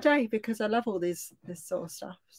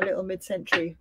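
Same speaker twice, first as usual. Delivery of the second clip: usual speaking voice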